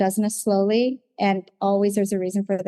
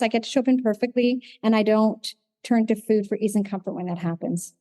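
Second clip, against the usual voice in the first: ordinary talk, nothing marked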